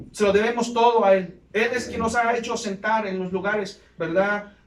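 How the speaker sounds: loud, fervent pulpit preaching; preaching at full force. Acted. Preacher exhorting the church to stay faithful, loud and fervent. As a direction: preach it hard to the congregation.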